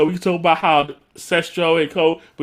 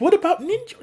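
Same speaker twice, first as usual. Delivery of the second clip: much higher, pleading little voice; high plaintive question. Retold or imitated. Delphine asking about Ninja, high plaintive voice. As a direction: ask it high and hopeful.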